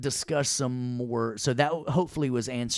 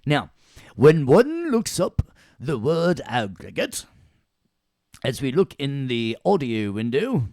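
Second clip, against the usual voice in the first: put-on British accent; 4 semitones higher, 7 dB louder than usual. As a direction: put on an exaggerated British lecturer. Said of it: "laughing at me talking British".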